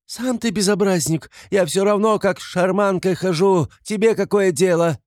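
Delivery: high-pitched, loud squabbling; indignant retort. A narrator's reading. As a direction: snap back shrilly at the policeman. Widow quarrels with policeman. (визгливо огрызается)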